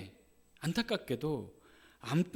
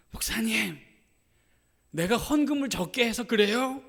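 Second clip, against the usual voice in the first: higher, louder, pleading cry of a broken mother; desperate plea; 7 semitones higher, 6 dB louder than usual. Retold or imitated. Grieving mother cries out to the pastor, higher and louder. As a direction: beg the pastor as if you caused it.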